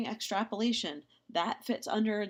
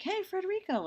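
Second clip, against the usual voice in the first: high, bright call across the aisle; calling out to a friend; 14 semitones higher than usual. Retold or imitated. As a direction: call out to Federico down another aisle. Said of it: "And you're like".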